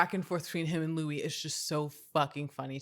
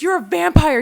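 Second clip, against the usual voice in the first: mock realization; 11 semitones higher, 12 dB louder than usual. Reporter retold realizing.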